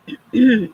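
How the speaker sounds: real rough throat clear; loud cough-like throat clear while sick. Real, not acted. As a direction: clear the throat hard to get the voice back. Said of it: "I'll probably be clearing my throat a lot".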